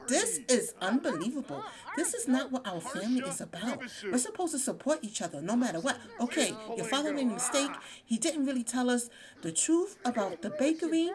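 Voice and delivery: high, fast indignant rant; acted scolding. [name] cursing at the family during game night, acted indignation. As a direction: voice an upset relative laying down the law. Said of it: "she's cursing".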